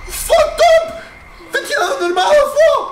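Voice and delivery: very high, very loud shrieking; a monster jumps him, full terrified screaming. Real, not acted. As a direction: scream in pure panic. Screaming at jump scare.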